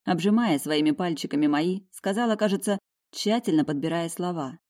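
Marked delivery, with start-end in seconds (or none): none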